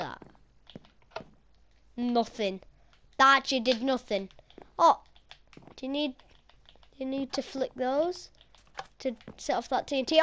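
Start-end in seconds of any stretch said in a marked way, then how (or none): none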